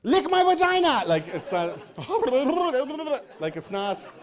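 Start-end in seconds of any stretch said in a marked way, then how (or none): 0.0-1.0 very high, loud and shrill mock hero; hammed-up movie character. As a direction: squeal it like an absurd action hero. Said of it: "the guy is like".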